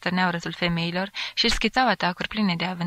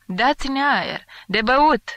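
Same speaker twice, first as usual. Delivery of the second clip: higher, louder crowd cries; shouts; 6 semitones higher, 5 dB louder, much slower than usual. A narrator's reading. Crammed guests demand air and drink, shouting. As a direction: call out for relief from the heat. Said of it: "Se auzeau strigăte".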